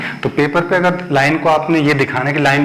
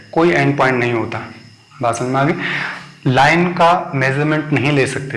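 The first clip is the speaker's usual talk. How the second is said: ordinary talk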